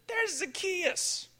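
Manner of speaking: put-on high, slow call of a child; a kid pointing him out from below; kid spotting him. Kid in the crowd calls up to the tree, high and drawn out. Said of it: "some kid probably first looked up and said".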